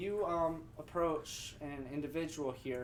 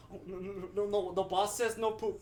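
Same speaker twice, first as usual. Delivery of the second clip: NPC voice; 5 semitones higher than usual. Acted. NPC voiced by game master.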